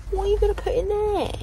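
high, loud mock disbelief; incredulous squeal. Real, not acted. Mocking the tiny glove box.

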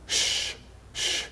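soft hush, almost breathed; gentle shushing. Acted. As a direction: shush the congregation tenderly. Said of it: "is saying to you".

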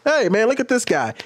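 high-pitched re-enacted heckle; mocking call-out. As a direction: call the room's attention to him, mockingly. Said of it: "When somebody started getting really mad at me".

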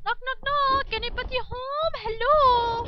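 high, loud call through a door. Pretend. Player knocking on a game door, calling out loudly. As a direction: call into the house.

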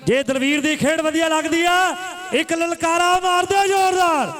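commentator's rallying cry, pitch rising to a peak; rousing crowd call. Real, not acted. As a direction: whip the crowd up for a big roar.

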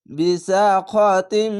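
slow "বিসাখাতিম" ending in a long hummed m; joined, stretched recitation. Shown as a demonstration. Teacher shows learners how to read it, long drawn. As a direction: join it up and hold the last m of "বিসাখাতিম". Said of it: "এভাবে পড়বেন".

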